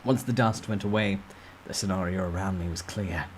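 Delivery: plain reading voice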